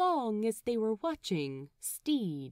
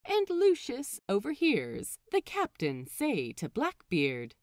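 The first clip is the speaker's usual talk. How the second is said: ordinary delivery, nothing marked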